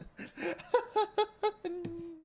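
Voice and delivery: high-pitched giggling fit; squeaky burst of real laughter; high helpless laugh. Real, not acted. Cracks up at own line.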